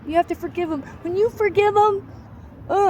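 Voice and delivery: very high-pitched pious impression; mocking churchgoers. Survivor mimics church people urging forgiveness, mocking high voice. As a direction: put on a high, sing-out church voice in mockery. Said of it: "you go to church and they're like".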